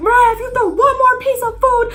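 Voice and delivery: shrill parody of a scolding teacher; mock angry teacher. Teacher threatens Mariah about the food, high loud mock scolding. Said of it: "He's like".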